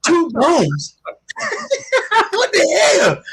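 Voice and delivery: high, cracking up in disbelief; breaking into laughter at the aunt; laughing disbelief. Retold or imitated. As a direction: laugh through the shock.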